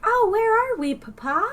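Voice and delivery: put-on high, breezy old Hollywood lilt; child playing a glamorous old movie star; transatlantic accent. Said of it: "Did you have the transatlantic accent".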